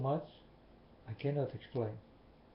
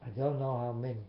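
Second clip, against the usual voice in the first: ordinary delivery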